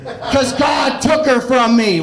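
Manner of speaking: high, slow, mock-heroic shout; Batman. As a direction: cry vengeance like a hammed-up superhero. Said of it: "it's like his Batman level thing".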